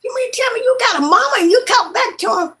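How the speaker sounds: high, fast disbelieving question; can't believe anyone would sass their mama; incredulous. Retold or imitated. Asking people who have mothers, high fast disbelief. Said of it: "I be looking at people".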